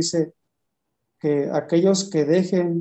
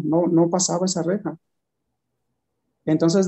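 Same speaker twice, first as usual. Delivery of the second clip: normal talking voice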